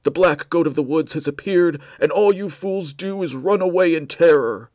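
loud, high, outraged cultist roar; furious shout. Acted. Cultist to Blair, shouting in fury at the betrayal. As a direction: thunder it like a fanatic scolding heresy. Cultist berating fleeing fools.

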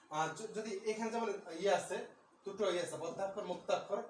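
ordinary talk

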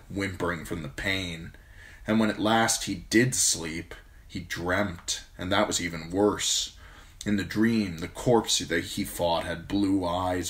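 usual speaking voice

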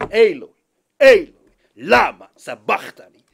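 loud, short anguished calls; loud cry. Acted. Preacher voicing Jesus' cry at the sermon's climax, loud. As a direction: cry it out to the rafters.

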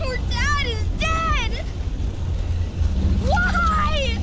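high, loud cries of betrayal; anguished shouting. Acted. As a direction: scream out grief and accusation. Boy learns his father is dead.

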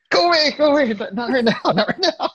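a high cartoonish go away, laughing at the end; squeaky brush-off. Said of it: "I'm like".

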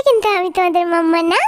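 ordinary talk

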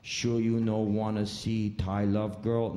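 slow, coaxing try; one last hopeful pitch; sulky driver. Retold or imitated.

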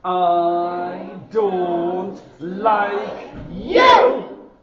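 spoken through gritted teeth, slow and loud; a slow, loud line forced through clenched teeth; teeth together. Retold or imitated. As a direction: grit your teeth and push the words out slowly. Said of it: "put your teeth together".

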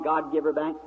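usual speaking voice

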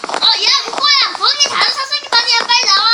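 높고 큰 소리로 다급하게 부르기; 교실 밖으로 나오라고 외치기. Acted. 교실 잘못 찾은 학생을 부름. (다급하게 외치는 목소리)

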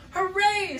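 high, loud cheer; a happy friend's shout of joy. Acted. Wesley cheering the vote, high and loud. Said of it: "said Wesley".

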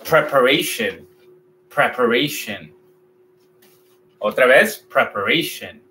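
preparation with a narrow A, mouth barely open, shun ending; English pronunciation. Shown as a demonstration. Teacher modeling English, mouth barely open. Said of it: "No abro la boca mucho".

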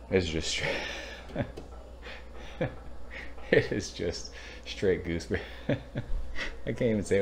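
trying not to laugh while saying it. Real, not acted. Giggling over beer aroma.

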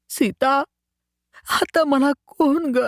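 very high, slow, broken plea; anguished cry. A narrator's reading. Searching the house for his wife, a very high anguished cry. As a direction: cry out, lost and alone. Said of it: "ओरडला".

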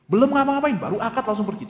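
high, loud burst of disbelief; incredulous exclamation. Real, not acted. Preacher to audience, groom left right after the wedding, high loud disbelief. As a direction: cry out in mock outrage that nothing happened yet.

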